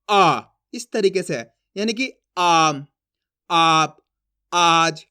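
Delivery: आम, आप, आज with a long, low, loud आ; stretched long aa. Shown as a demonstration. Teacher drilling example words with a long, low, loud आ. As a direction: stretch the आ slowly in each word. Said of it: "यहाँ पे जोर लग रहा है".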